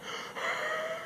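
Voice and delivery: high squeaky wheezing laugh; wheezy voice. Shown as a demonstration. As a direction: wheeze it out like Wile E. Coyote. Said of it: "like that, that's wheezing".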